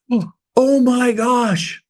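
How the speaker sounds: high, slow mock amazement; awed surprise. Salespeople see giant pies before Thanksgiving, imitated awed surprise. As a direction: draw it out as if wowed by a gift.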